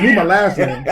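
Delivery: laughing. Real, not acted. Laughing over the coach's nickname.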